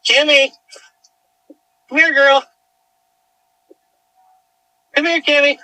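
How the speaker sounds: loud, high call across a field; calling the dog. Real, not acted. Owner calling lost dog Cammy in the dark field, loud high calls.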